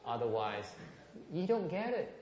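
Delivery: ordinary talk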